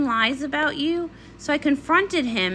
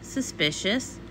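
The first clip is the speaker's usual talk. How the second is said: hushed suspicion; 5 semitones lower, 7 dB quieter, slower than usual. Acted. Dramatic detective remark.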